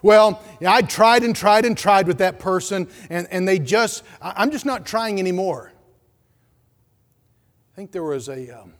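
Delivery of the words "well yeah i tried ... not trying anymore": bursts out loud, then fades to plain; fed-up church member. Acted. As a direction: play a believer who's given up on someone.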